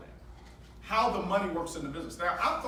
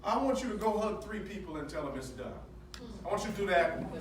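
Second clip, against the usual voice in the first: ordinary talk, nothing marked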